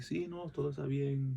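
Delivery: softly courteous Spanish; mild, respectful Spanish small talk; polite to family. Shown as a demonstration. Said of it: "I meet somebody's family".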